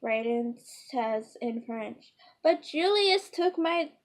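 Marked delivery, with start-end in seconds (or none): 2.4-3.9 put-on whiny child. Child complains about stolen toy.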